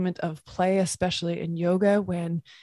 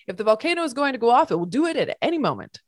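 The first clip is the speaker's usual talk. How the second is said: fast, raised urgent warning; 5 semitones higher, 7 dB louder, faster than usual. A narrator's reading. Professor warning the ponies to hurry, fast and urgent. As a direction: hurry them along, pressing.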